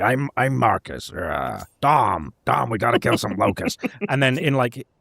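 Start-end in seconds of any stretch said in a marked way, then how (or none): 0.0-3.8 lightly gruff put-on tough-guy voice; Marcus. Soldier rallying his buddy against locusts, mock gruff voice. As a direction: play a gruff soldier, a little hammed-up. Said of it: "he puts on his kind of gruff accent".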